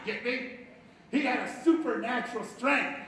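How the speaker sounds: normal talking voice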